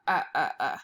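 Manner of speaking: low repeated uh uh uh; teacher modelling short u for kids; short u sound. Shown as a demonstration. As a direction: drill the short vowel uh three times. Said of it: "short U sound like umbrella".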